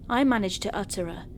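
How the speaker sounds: ordinary delivery, nothing marked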